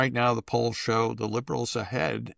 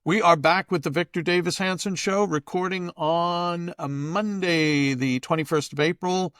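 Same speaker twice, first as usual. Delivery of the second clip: announcer intro; 5 semitones higher than usual. Acted. Host opens the show.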